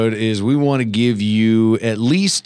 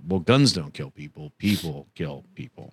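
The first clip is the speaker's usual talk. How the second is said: mock lobbyist; 7 semitones lower, 6 dB quieter than usual. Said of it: "That is what they say".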